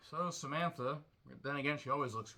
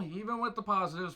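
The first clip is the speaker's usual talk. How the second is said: ordinary talk, nothing marked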